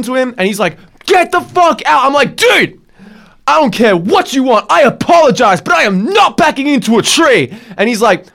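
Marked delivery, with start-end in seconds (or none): 1.0-2.0 high, loud shouted command; barked order. Retold or imitated. Angry man shouting at the trapped driver to reverse, barked. 2.4-7.5 higher, louder putting your foot down; firm shouted refusal. Retold or imitated. Driver shouting that he won't back into a tree, firm.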